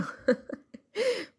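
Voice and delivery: high burst of laughter; a quick high giggle after the fake-calm scolding. Real, not acted. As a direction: laugh at your own joke. Laughs at her own fake calm.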